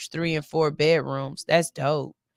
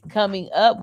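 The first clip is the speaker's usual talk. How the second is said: usual speaking voice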